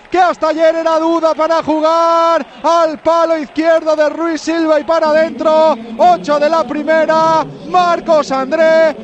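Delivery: loud, slow, emphatic goal recap; breakneck goal commentary. Real, not acted. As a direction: keep yelling the replay, stretching names. Repaso del gol tras el grito. (repaso del gol a gritos, arrastrando nombres)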